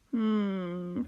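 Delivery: long pondering hum; thoughtful. A narrator's reading. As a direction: hum as if mulling it over. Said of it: "Squid said thoughtfully".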